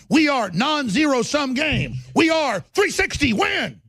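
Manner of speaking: loud, relentless tirade at enemies; furious shouted rant. Real, not acted. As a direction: hammer every line at full volume. Holds a full-force rant.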